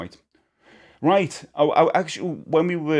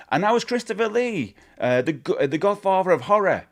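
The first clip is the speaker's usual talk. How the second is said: imitating interviewer; 8 semitones higher, 3 dB louder than usual. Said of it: "the woman turns to the camera and says".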